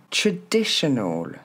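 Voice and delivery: TRADITIONAL as "tre-DI-she-nol", slowly; drawn-out "traditional", falling "ol"; model pronunciation. Shown as a demonstration. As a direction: stress DI in "traditional", neutral first vowel. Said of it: "c'est pas un A au début".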